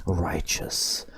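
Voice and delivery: plain reading voice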